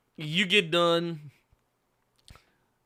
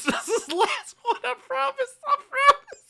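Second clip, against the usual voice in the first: laughing. Real, not acted. Promising between fits of laughter.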